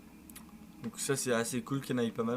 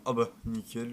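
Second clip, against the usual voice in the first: ordinary delivery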